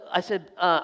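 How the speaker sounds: normal talking voice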